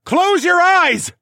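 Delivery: drawn-out, very high scream; screamed warning. Acted. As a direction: scream it slowly at full force. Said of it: "I screamed again".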